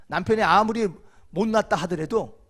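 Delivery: ordinary delivery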